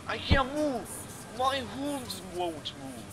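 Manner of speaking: acted panic. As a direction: wail it in theatrical dismay. Acting out game character's panic.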